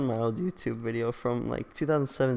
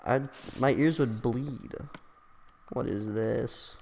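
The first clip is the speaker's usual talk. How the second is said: usual speaking voice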